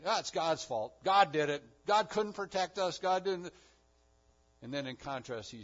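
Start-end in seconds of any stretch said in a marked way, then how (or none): 0.0-3.5 mock complaint. Mocking the people's complaint.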